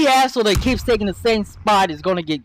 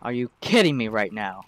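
muttered disbelief; 13 semitones lower, 7 dB quieter than usual. Real, not acted. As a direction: lower and softer, under the breath. Repeated outburst, first muttered.

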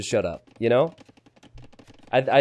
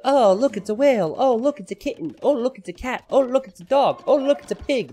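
high, gushing "oh look" voice; imitating his girlfriend's sweet animal gushing; mock gushing girlfriend; 9 semitones higher than usual. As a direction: coo over each animal like a doting girlfriend. Mimicking girlfriend's animal posts.

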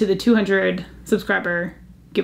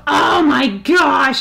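slow, louder, drawn-out gush; overwhelmed; 5 semitones higher, 5 dB louder, much slower than usual. Real, not acted. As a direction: stretch it out, overcome. Overwhelmed by cute find.

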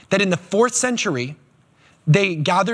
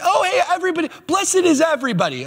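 much higher, airy mock cheer; mocking a vague preacher, much higher; 11 semitones higher than usual. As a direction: parody an empty blanket blessing. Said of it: "Not just".

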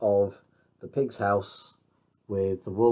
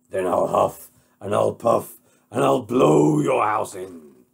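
threatening wolf; 7 dB louder than usual. Acted. Wolf threatens to blow the house in, loud and menacing.

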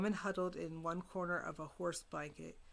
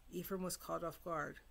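plain reading voice

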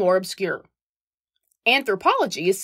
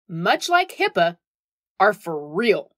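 ordinary talk

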